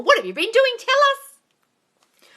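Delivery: high, loud coaxing; excited prompting; eager. Real, not acted. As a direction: urge her to share. Eager question to viewer.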